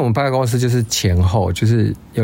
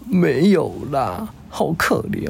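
mimicking teary coworker; 5 semitones higher, slower than usual. Voicing crying coworker.

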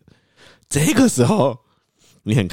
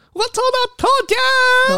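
ecstatic; 5 dB louder, much slower than usual. Retold or imitated. Quoting friend's winning message.

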